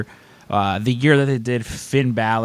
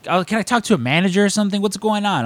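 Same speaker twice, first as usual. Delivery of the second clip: fast, high-pitched demand for a manager; mock outraged customer; retold yelling; 9 semitones higher, faster than usual. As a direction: push hard, pitched way up. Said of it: "I yelled at poor Melissa".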